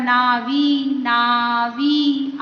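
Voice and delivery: नावी stretched slowly to show the आवी sound; teacher stretching नावी for the class; drawn-out demo. Shown as a demonstration. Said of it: "तो आप इसे बोलकर देखिए".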